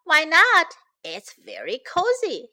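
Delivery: high, loud comeback; breezy retort. Acted. Hedgehog answers the farm dog, emphatic retort. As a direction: toss it back emphatically.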